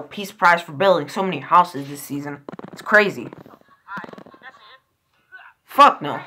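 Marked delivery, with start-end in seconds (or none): none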